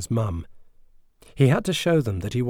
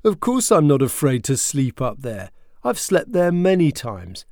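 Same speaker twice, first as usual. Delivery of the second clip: defensive retort; 6 semitones higher, 8 dB louder than usual. Acted. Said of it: "Joshua retaliated".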